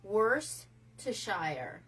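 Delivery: "Worcestershire" said very slowly as "WUSS-ter-shire"; drawn out, "Worcestershire" syllable by syllable; slow correct pronunciation. Shown as a demonstration. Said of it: "It's Worcestershire".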